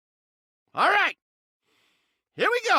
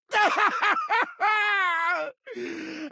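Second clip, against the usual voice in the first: frantic laugh breaking into a cry; manic laugh-cry; 6 semitones higher than usual. Acted. Rat creature laughing wildly over his kill. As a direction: cackle half crazed.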